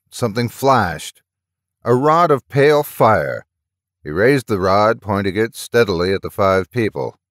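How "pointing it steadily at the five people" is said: plain reading voice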